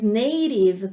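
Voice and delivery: native with the t tapped like a quick r, slowly; American flap t. Shown as a demonstration. Teacher shows students the American native. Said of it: "como um R".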